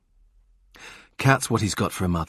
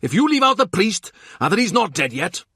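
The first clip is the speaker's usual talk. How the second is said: high, loud insistent yell; 12 semitones higher, 5 dB louder than usual. Acted. Morgan yells back at Kat, high and loud. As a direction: shout him down. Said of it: "Morgan yells".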